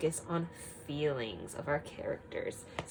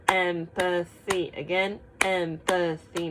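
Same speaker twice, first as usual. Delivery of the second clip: EM-pa-thy, slow and loud, syllable by syllable; clapped syllables; 4 dB louder, slower than usual. Shown as a demonstration. Teacher claps out empathy with the class, syllable by syllable. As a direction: clap out each beat of empathy. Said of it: "Let's clap it out".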